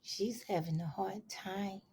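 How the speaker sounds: soft whispered aside. Real, not acted. Pausing the story to whisper an aside to listeners. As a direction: lean in and whisper it.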